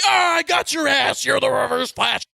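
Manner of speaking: Barry exposing the villain, hammed-up shout; mock triumphant shout. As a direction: call him out triumphantly. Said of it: "And he's like".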